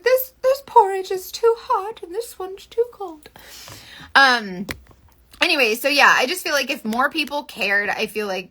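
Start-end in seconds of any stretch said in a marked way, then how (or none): 0.0-3.3 put-on high, slow storybook girl voice, lightly done; mimicking Goldilocks from the Three Bears. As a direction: read it like a fairy-tale girl. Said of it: "makes me think of the Three Bears".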